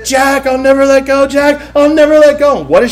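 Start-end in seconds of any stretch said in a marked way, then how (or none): 0.1-1.2 higher, swelling melodramatic plea; mock Rose pleading. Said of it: "she's like". 1.3-2.6 higher, swelling melodramatic plea; mock Rose pleading. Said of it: "she's like".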